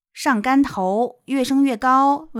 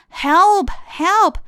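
higher, drawn-out acted plea; page crying out; 9 semitones higher, 5 dB louder than usual. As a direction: stretch out a storybook cry for help. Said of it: "cried the page".

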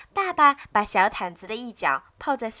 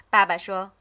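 ordinary delivery

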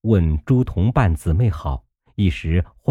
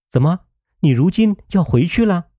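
alarmed question, pitched well higher; startled; 10 semitones higher, faster than usual. A narrator's reading. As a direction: caught off guard, blurting it out. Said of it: "不觉吃一惊".

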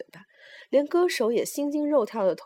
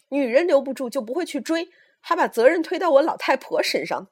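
high, loud rebuke; old mother's heated lecture; scolding tirade; 6 semitones higher, 6 dB louder than usual. Acted. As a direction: berate them with rising indignation. Old mother berates the young men.

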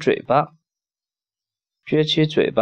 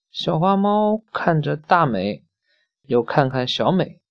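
usual speaking voice

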